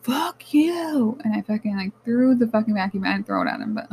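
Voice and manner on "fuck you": high mock yell of fury; a pitched-up, retold shout at the boyfriend; retold scream. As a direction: yell it, reliving the fight. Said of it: "I scream".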